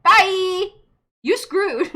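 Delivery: loud, high mocking send-off; bye-and-good-luck taunt, punched out; gleeful taunt. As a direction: crow it like you're escaping trouble. Mocking farewell to son.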